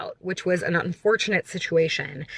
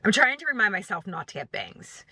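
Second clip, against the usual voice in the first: usual speaking voice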